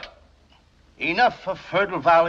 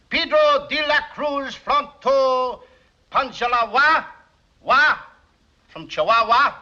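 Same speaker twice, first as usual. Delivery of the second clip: grand announcement; 5 semitones higher, slower than usual. Acted. Speaker names the statesman to the audience, slow grand proclamation. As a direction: roll the long name out like an orator. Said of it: "from one of your statesmen".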